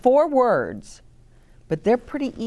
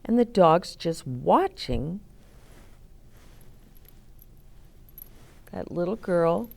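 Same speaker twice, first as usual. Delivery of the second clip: musing note-taking; 6 dB quieter than usual. Pretend. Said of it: "you might even take notes".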